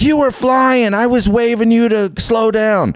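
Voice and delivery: very high, strained mock yell; high-pitched accusing holler, hammed-up; imitated angry driver. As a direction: mimic an outraged woman yelling from her truck. Retold angry driver yelling accusation.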